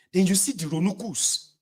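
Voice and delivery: ordinary talk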